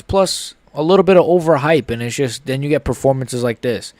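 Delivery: ordinary talk, nothing marked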